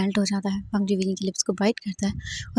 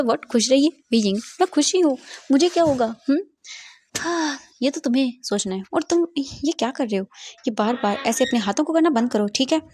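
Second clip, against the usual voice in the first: teasing scold; 5 semitones higher, 4 dB louder than usual. Acted. Boss mocks and scolds.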